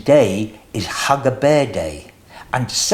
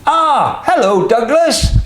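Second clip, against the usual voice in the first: much higher, loud sheep voice; sheep barking a greeting; 10 semitones higher, 4 dB louder than usual. Acted. Sheep greeting Douglas at the door, higher and loud. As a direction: bark out a cheery hello as the sheep. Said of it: "barked the sheep".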